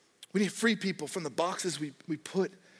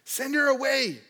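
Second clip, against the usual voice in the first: higher, louder, urgent begging; pleading disciples; 9 semitones higher, 4 dB louder than usual. Retold or imitated. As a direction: play the pestered disciples pushing him.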